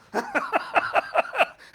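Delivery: high loud gloating cackle; gloating laugh. Retold or imitated. Young man gloating over outliving everyone, loud cackle. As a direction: cackle in triumph.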